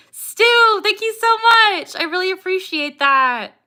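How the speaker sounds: high, loud, gushing thank-you; excited thanks. Real, not acted. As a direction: burst out with delighted gratitude. Thanking a viewer for donation.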